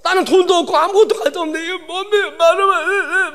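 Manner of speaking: high, hammed-up wailing prayer; acted bawling in bitter frustration; mock sobbing tirade, breaking into whimpers; crying complaint. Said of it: "막 이러고 울었어요".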